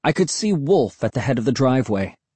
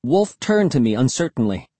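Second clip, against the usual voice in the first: plain reading voice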